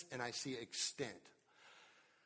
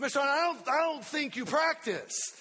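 mock teacher; 10 semitones higher, 9 dB louder than usual. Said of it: "she said".

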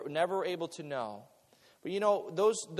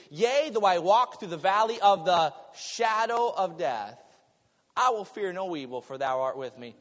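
solemn scripture recital; 2 semitones higher, 7 dB louder than usual. Acted. Quotes Psalm twenty-three.

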